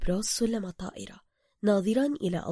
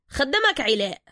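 pushy Cairo driver; 5 semitones higher, 6 dB louder than usual. Acted. Driver character voice.

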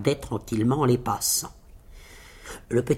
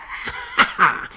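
self-satisfied laugh in character; rire triomphant. A narrator's reading. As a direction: laugh proudly at one's own feat. Tailleur fier de son exploit. (rire de fanfaron)

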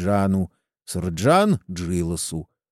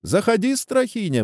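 high-pitched welcoming exclamation; exclaimed invitation; 12 semitones higher than usual. A narrator's reading. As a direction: invite him in with a high, lifted call. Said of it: "воскликнул Ичеба". (радушный возглас высоким голосом)

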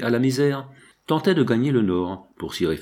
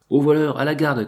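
higher call for the guard; crying out; 5 semitones higher, 4 dB louder than usual. Acted. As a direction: cry out for the guard, pitched up. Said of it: "cria à son tour le marchand". (appel à la garde, voix montée)

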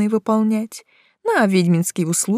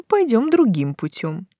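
ordinary delivery

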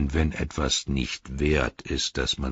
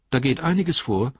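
put-on high, quick, excited young voice; eager hobbit; 11 semitones higher, 5 dB louder, faster than usual. Hobbit Merry answers the Ent.

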